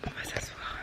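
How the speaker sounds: barely audible unvoiced whisper; faint whisper. Real, not acted. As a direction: whisper as if someone might hear. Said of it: "Je sais pas pourquoi je chuchote".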